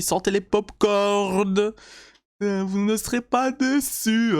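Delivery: slow, high hype voice; hyped announcer. Shown as a demonstration. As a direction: drag it out like a teaser host. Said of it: "comme je vous dis".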